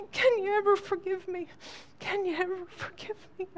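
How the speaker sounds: high, crying plea, voice breaking; sobbing confession. Retold or imitated. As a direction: beg forgiveness through tears. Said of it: "he broke out into tears and began to just sob".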